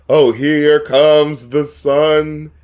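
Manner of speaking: very slow, stretched hopeful question; slow rising wonder; drawn-out wondering. Retold or imitated. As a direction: draw it out like a dawning hope. Imitating hopeful recognition.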